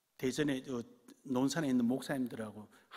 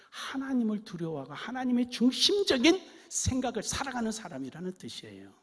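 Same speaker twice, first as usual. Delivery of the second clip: loud, much higher sermon climax; preaching at full force; 5 semitones higher, 11 dB louder than usual. Acted. Pastor urging his church to be God's people, loud fervent preaching. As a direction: proclaim it to the whole hall.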